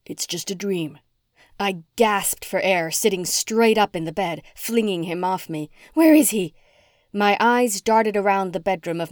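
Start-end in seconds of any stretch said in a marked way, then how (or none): 0.0-1.0 soothing fiancé. A narrator's reading. 5.9-6.5 high, loud frantic question; panicked demand. A narrator's reading. Heroine waking in panic asking for her cat, loud and high.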